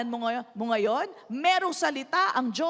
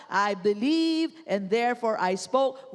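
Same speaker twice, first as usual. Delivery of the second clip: "believe" drawn out, slow and weighty; slow scripture emphasis; 5 semitones lower, slower than usual. Shown as a demonstration. Pastor reading the verse aloud to the congregation, slow and emphatic. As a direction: read "I believe and therefore I spoke" slowly, leaning on "believe". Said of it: "Ito po ang nakasulat".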